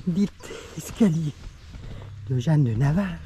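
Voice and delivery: winded from climbing the stairs; puffing between words; out of breath. Real, not acted. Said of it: "je suis essoufflé".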